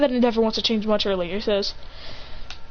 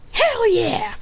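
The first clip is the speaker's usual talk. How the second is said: high-pitched eager outburst; excited cheer; 10 semitones higher than usual. Acted. Son invited to show his power, excited cheer. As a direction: jump at the chance.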